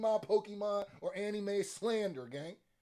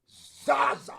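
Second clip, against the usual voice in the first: hyped exclamation; 4 semitones higher, 5 dB louder, slower than usual. Real, not acted. Hyped reaction to own joke.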